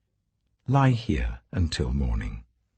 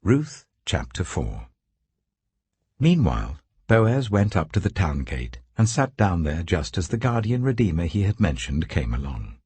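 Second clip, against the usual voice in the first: usual speaking voice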